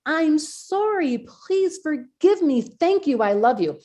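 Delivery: slow, high, drawn-out sincerity; heartfelt, meaning it. Retold or imitated. Forgiving the person at last, heartfelt and slow. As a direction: stretch each phrase out as if truly meant. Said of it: "And I actually meant it".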